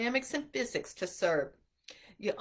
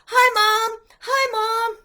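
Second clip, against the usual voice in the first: imitating a player, much higher and much louder, calling out; excited greeting; 11 semitones higher, 12 dB louder, slower than usual. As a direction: shout a giddy hello at the camera, up high. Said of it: "he's like".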